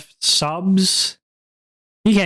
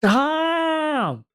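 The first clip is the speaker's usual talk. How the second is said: sudden high yell; shout; 12 semitones higher than usual. Real, not acted. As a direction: yell stop at the chat. Yelling at chat to stop.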